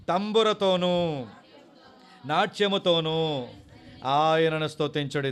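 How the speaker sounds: slow, loud, drawn-out psalm reading; solemn scripture reading. Acted. Preacher reading Psalm 150 aloud, slow and loud. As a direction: proclaim each phrase slowly.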